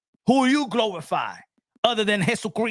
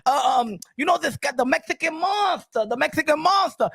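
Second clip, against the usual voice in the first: imitating Tyson; 6 semitones higher than usual. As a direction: ham up a confused Tyson. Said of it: "And he said".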